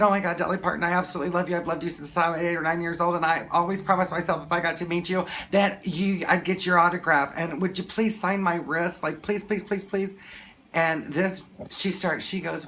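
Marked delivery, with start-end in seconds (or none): 0.0-10.1 higher-pitched, hurried fan plea; retold starstruck begging; gushing fan. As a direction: gush it all out in one rush. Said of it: "I have to tell you this really quickly".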